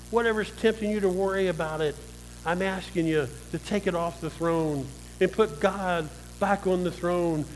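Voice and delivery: ordinary delivery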